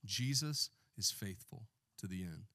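ordinary talk

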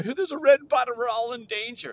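high, loud mock panic; freaking out. Shopper warns the store of danger, mock freak-out. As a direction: raise a hammed-up alarm. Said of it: "I'm freaking out".

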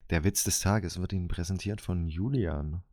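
low, formal sponsor announcement; ad announcer voice. Pretend. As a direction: read it like a radio sponsor spot. Sponsor-Ansage im Werbestil. (tiefe Werbesprecherstimme)

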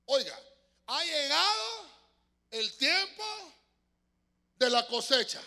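proclama lenta y fuerte, aguda; voz potente del ángel. Acted. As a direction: clama como ángel desde el templo. Repite el clamor del ángel. (voz potente, lenta y solemne)

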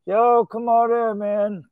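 imitating a guy calling a guest in without getting up; retold yell. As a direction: call out lazily from the couch. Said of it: "the guy yells through the door".

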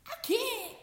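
high, loud mock wail; mock tearful protest. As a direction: protest as if about to cry. Acted tearful reply to scolding.

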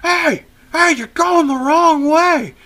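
high, loud drawn-out holler; mock yell from behind, stretched out; retold scream. Uncle hollers a warning to the rider ahead, mock screaming. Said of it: "my uncle screaming behind me".

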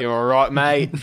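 put-on Aussie mate voice; Australian accent. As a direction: say it like a casual Australian. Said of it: "do more Australian J F K Junior".

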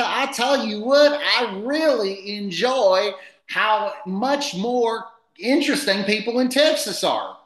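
high, put-on folksy Texan; fake character voice. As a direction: play a chatty made-up guest. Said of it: "a fake character".

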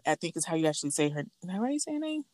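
normal talking voice

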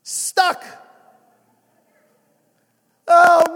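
loud, high, impassioned preaching. Acted. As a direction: preach it hard and build. Preaching at full force.

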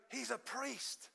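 very soft, hushed mock aside to a friend; imitating mouthed aside. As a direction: drop right down, as if secretly mouthing it. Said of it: "She's kind of mouthing".

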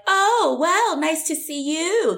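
mock-sweet, high drawn-out greeting; passive-aggressive. Coaching a friend to snub a girl, passive-aggressive. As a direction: feign pleasantness in a high, slow voice. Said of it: "just passive aggressive".

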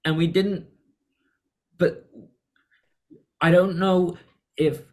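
rising pitch, slow and hesitant; halting stammer. Acted. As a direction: stumble through it like a shaken man who can't find the words. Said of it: "it just comes out in".

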